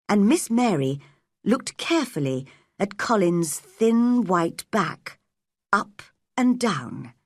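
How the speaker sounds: ordinary delivery, nothing marked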